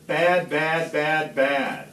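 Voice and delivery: mimicking the Lorax's nagging; sing-song mock scolding; mocking nag. Once-ler mocking the Lorax's nagging, sing-song. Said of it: "yap, and say".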